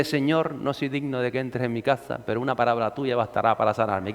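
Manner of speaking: soft, low recited prayer. Retold or imitated. Preacher reciting the mass verse softly, as a prayer. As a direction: murmur it like a memorised mass response. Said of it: "que dice".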